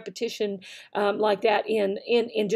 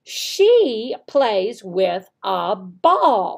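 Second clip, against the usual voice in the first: very slow, word by word; deliberate pointing pace; 3 semitones lower, 3 dB louder, much slower than usual. Shown as a demonstration. Teacher modelling a sentence slowly, word by word. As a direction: space the words out for pointing. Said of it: "No, I'm gonna do".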